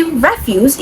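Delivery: "refuse" as a noun, REF-use; stress on first syllable. Shown as a demonstration. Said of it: "is a noun".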